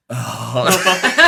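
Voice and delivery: big, loud "oh" outburst; loud acted groan. Fighter dragged to hospital with no limbs, loud groan. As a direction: groan it out at full volume. Acts out reaction on being carried.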